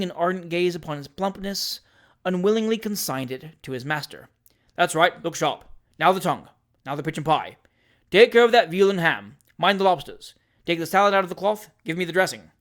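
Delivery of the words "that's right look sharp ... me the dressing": quick, loud bustling commands; hurried orders. A narrator's reading. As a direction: rattle off orders in a rush. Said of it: "the hurried orders".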